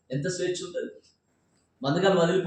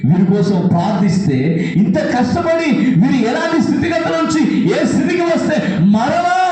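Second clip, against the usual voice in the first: fiery preaching; 3 semitones higher, 8 dB louder than usual. Acted. Preacher rousing the congregation about being used, fiery preaching. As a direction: preach it out to the back rows.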